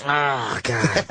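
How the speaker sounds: low disgusted groan of a voice; imitating Patrice. Patrice judging comics at the club, low disgusted voice. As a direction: sink the voice down, disgusted. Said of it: "He's like".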